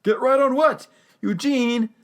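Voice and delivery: high, loud incredulous call; imitated exasperated shout. Whitaker tries to get through to Eugene, mock exasperated shout. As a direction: demand an answer, voice pitched right up.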